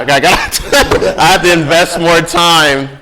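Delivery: amused laugh mid-sentence. Real, not acted. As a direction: laugh while getting the words out. Laughing while answering.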